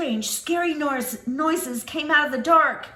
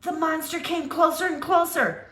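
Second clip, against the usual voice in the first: usual speaking voice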